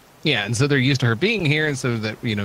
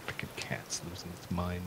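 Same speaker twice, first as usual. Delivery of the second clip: muttered under the breath; mumbling; 4 semitones lower, 14 dB quieter than usual. Real, not acted. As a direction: grumble it quietly to yourself. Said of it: "You're like an old man mumbling".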